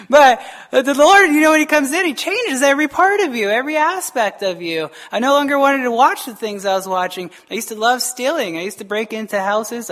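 ordinary talk, nothing marked

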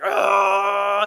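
drawn-out car revving noise; mouth-made engine noise; imitated engine roar. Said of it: "the whole car's like".

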